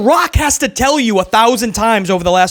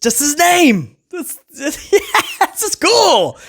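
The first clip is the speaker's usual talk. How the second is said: amused disbelief; 7 semitones higher, slower than usual. Real, not acted.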